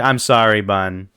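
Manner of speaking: usual speaking voice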